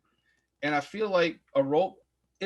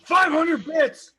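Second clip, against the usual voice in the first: slow, high, loud yell; excited shout; 10 dB louder, much slower than usual. Real, not acted. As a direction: belt it out gleefully. Shouting at stream bits.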